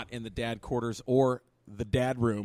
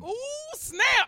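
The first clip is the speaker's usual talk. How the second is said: ooh snap; 4 dB louder than usual. Pretend. Mock reaction to microphone joke.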